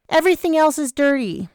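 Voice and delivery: put-on high, offhand young woman; Vanessa voice.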